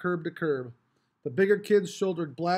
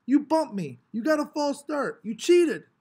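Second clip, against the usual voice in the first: much higher, loud accusing whine; angry protest; 9 semitones higher, 5 dB louder than usual. A narrator's reading. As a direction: blame the starter. Mars accusing Maniac.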